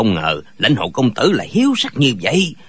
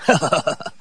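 low, acted chuckling laugh; hearty "ha ha" laugh; 6 semitones lower, 4 dB quieter than usual. A narrator's reading. Captive amused at his comrade, low hearty laugh. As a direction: laugh "ha ha" in a deep character voice. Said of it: "cười ha hả nói".

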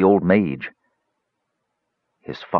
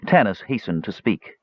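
plain reading voice

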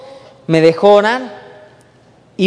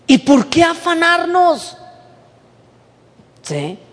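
higher, louder preaching at full force; 8 semitones higher, 3 dB louder than usual. Acted. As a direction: preach it out to the congregation. Preacher raises voice again.

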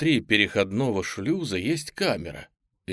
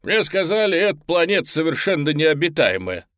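high, loud dinosaur voice; raptor voice; 7 semitones higher, 7 dB louder than usual. Acted. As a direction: speak as a chatty raptor. Raptor speaking.

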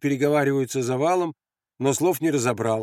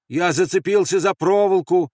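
loud, high protest; calling out; 6 semitones higher, 6 dB louder than usual. Acted. Andres caught on the wire, calling out. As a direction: call back up to the guards.

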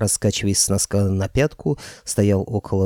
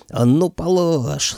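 yelling; 6 semitones higher, much slower than usual. A narrator's reading. Old man roaring at Koschei to drop the clerk. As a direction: roar the command at him. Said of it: "заорал мой дед".

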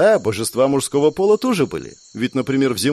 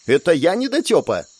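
raised-pitch protest, mild yell; indignant cry; 5 semitones higher than usual. A narrator's reading. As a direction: cry out as if insulted. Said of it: "закричала Глория".

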